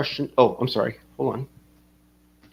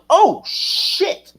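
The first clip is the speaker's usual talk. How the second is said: mock startled backing off; 10 semitones higher than usual. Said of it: "I was like".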